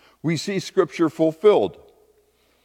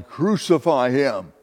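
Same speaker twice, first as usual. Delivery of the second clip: ordinary delivery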